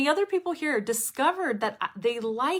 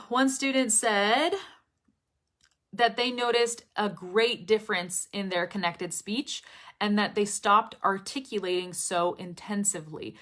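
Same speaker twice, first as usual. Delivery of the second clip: usual speaking voice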